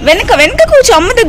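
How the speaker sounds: loud, high-pitched instruction; high motherly call. Real, not acted. Mother calling instructions to child.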